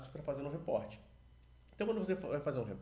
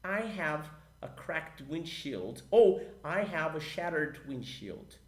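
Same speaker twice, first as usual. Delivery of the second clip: very slow, louder English model line; slow model sentence; 4 dB louder, much slower than usual. Shown as a demonstration. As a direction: spell the sentence out slowly for students. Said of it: "Como é que fica essa frase em inglês?".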